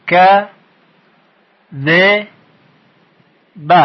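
"ک، ذ، ب" as "ka, ze, ba", long pauses between; split into syllables. Shown as a demonstration. Teacher answers how many parts, syllable by syllable. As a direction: break it into three short separate syllables.

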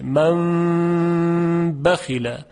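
من بخل chanted very slowly, n turned to a held nasal m; Quranic recitation. Shown as a demonstration.

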